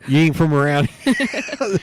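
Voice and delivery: high, slow, drawn-out Southern tease; mock country drawl. Teasing the friend after the hot sauce, slow mock drawl. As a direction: drawl it out slowly.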